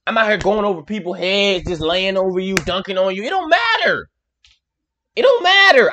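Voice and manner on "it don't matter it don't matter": high-pitched, insistent exclamation; excited, high-flying trash talk; hyped boast. Acted. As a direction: brag it out in a raised pitch. Hyped bragging as the player.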